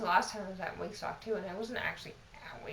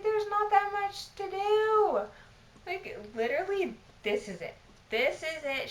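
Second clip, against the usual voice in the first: indignant retold protest; 11 semitones higher, 7 dB louder than usual. Protesting to the manager that there's not much work, exasperated. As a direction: act out snapping back in disbelief.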